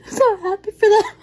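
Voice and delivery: high, tearful voice breaking with happy sobs; overwhelmed happy tears, voice cracking high; emotional crying reaction. Real, not acted. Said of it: "and this was my reaction".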